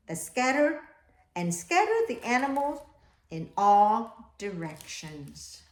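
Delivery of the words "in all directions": usual speaking voice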